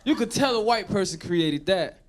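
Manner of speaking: normal talking voice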